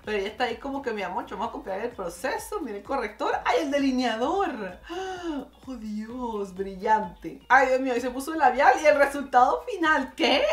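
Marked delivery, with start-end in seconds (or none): none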